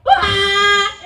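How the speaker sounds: loud shocked cry of the sprayed brother; mock scream. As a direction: let out a startled yelp.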